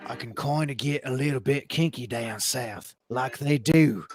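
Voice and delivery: low, mumbling mock Australian; a hint of an Australian accent, low and soft; Australian accent attempt. Shown as a demonstration. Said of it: "can I do Australian?".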